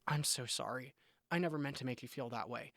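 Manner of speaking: very soft, low apology; humbled apology. Retold or imitated. Husband apologizing to his hurt wife, humbled and hushed. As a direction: drop to a hushed, humble murmur. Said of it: "my heart was humbled and I said".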